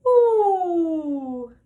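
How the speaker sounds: high loud sighing ooh gliding down; light sighing ooh. Shown as a demonstration. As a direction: let the ooh slide down like a sigh. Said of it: "let's just do a light sigh".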